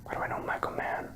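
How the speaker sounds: hushed whisper. Real, not acted. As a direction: whisper it to yourself. Whispered aside.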